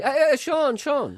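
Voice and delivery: high, loud hail dropping on the second name; calling out. Retold or imitated. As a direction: call him up the street. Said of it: "No, I would say".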